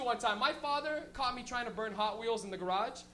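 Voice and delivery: ordinary talk, nothing marked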